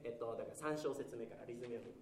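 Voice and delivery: usual speaking voice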